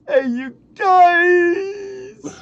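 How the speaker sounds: slow, high, strained Sloth yell; Sloth Goonies voice. Retold or imitated. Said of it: "In my Sloth Goonie voice".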